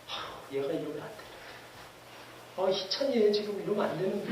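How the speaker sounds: soft, worried murmur like a fretting parent. Retold or imitated. The Spirit fretting over a straying believer, soft and worried. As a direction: sigh it out quietly, fretting over a wayward child. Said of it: "마치 안타까워하시는 부모님처럼".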